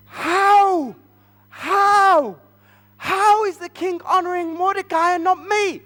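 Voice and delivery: high, loud indignant wail; outraged. Acted. Haman passed over for Mordecai, outraged. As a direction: cry out in wounded disbelief.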